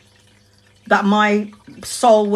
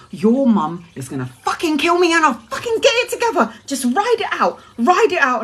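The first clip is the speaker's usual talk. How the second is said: higher put-on friend voice; voicing friend; 6 semitones higher than usual. Friend Shiv telling her to ride it out, in a higher voice. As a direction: play the panicky friend, pitched up.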